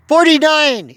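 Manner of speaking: loud, high exasperated cry of the score; exasperated shout. Real, not acted. Fan vlogger shouting at complaining fans, exasperated. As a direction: yell it in disbelief.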